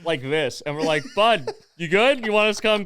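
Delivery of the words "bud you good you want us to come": calling up, concerned. Retold or imitated. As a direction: call up gently but loud. Calling up to Trevor.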